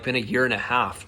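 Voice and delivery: usual speaking voice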